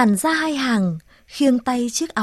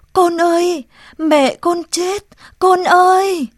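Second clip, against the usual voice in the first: high, loud, slowed anguished call; desperate calling; 6 semitones higher, 6 dB louder, slower than usual. Acted. Girl calling Côn that his mother died, anguished call. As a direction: cry out the terrible news across the yard. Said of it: "gọi yếu ớt".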